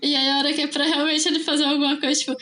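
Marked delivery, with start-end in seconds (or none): none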